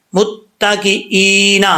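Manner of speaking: متکئین very slowly, doubled t held; slow demo. Shown as a demonstration. Teacher demonstrates متکئین slowly. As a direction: stretch متکئین, press the doubled t. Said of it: "کر کے دکھا دیتا ہوں".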